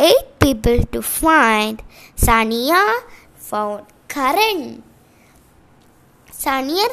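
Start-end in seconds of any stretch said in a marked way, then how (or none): none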